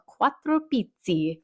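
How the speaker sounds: put-on Italian accent on the name. Shown as a demonstration. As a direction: say it in your best Italian accent. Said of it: "My best Italian accent".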